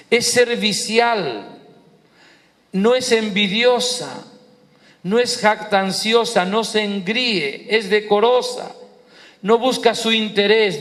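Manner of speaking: high, declaimed list with pauses between items; scripture recitation. Acted. Preacher reciting the qualities of love, high declamation.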